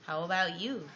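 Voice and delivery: usual speaking voice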